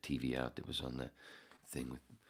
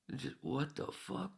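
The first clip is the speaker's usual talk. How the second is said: 1 incredulous; 11 semitones higher, 3 dB quieter than usual. Real, not acted. Outraged exclamation, very high.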